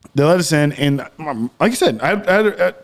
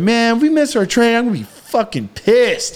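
higher, put-on voice of an annoyed friend; a light impression of a cranky friend; Joe's way of talking; 8 semitones higher than usual. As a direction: mimic the buddy griping about the train. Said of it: "oh, you know how he would talk".

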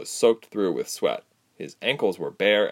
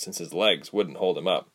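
ordinary delivery, nothing marked

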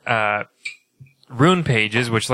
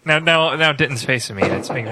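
ordinary delivery

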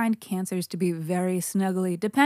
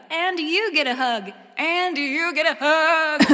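higher and louder giveaway; building excitement; 8 semitones higher, 3 dB louder than usual. Pretend. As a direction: build it like a game show host.

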